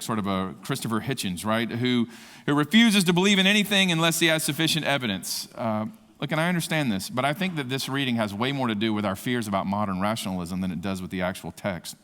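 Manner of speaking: ordinary talk, nothing marked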